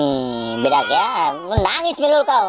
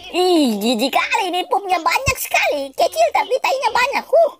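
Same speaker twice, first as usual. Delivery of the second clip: high squealing disgust, half laughing; 7 semitones higher than usual. Real, not acted. Gamer cleaning a baby's poop, squealing in disgust.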